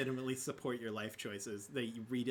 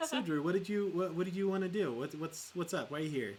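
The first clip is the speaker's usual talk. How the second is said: normal talking voice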